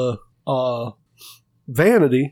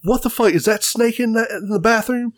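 lightly voiced upset wife, pitched up; mock alarmed woman; 7 semitones higher than usual. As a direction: imitate an alarmed wife at ordinary volume. Kimberly's alarmed outburst, retold.

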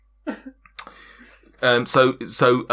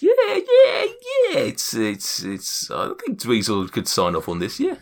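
eager gnome agreeing; 3 semitones higher, slower than usual. Acted. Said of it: "and he says".

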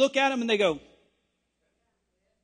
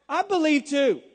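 higher, brighter eager believer; eager Thomas; 6 semitones higher, 5 dB louder than usual. Acted. Thomas hurrying to believe, a mild mock eagerness. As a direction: jump in eagerly, I believe too.

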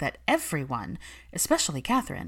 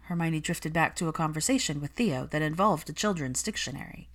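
usual speaking voice

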